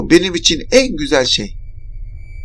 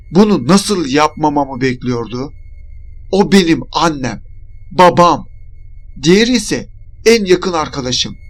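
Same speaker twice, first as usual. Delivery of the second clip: ordinary delivery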